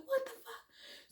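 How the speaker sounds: very high, soft squeal of disbelief; shocked squeal. Retold or imitated. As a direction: squeak it out slowly, high and small. Acting out her shocked reaction.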